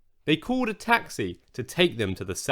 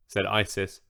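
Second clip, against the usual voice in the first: ordinary delivery, nothing marked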